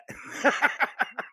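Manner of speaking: high, breathy burst of laughter. Real, not acted. Laughing after telling the podcast crew about the new cat. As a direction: laugh out at the punchline. Laughs at his own story.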